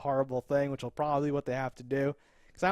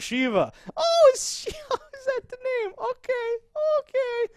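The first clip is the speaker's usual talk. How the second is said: much higher squeaky flustered voice, laughing through it; mock surprise; 5 dB louder than usual. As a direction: fake delighted confusion, up high. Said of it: "He's like".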